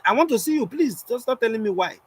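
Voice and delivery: high-pitched, indignant mimicry; imitated furious lady. Lady confronting the performer after the show, mock fury. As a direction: act out an upset woman demanding a meeting. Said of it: "very furious and pissed".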